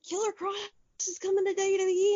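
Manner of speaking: high, fast, gushing; excited announcement. Retold or imitated. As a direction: blurt out the big news. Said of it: "I said".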